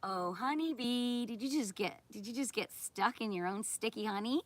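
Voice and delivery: slow, sickly-sweet taunt; mock sweet voice. Real, not acted. Streamer taunting the honeybee channel owner, sugary and mocking.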